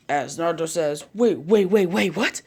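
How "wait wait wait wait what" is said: raised, bouncing string of protests ending upward; loud, rattled double take; flustered disbelief. A narrator's reading. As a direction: stammer out your shock. Naruto stunned by the arrangement.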